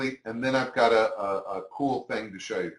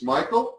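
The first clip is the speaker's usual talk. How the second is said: Michael raised like a question, higher and a touch louder; 5 semitones higher, 4 dB louder than usual. Shown as a demonstration. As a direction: ask Michael with a clear upward lift. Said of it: "You just say it like a question".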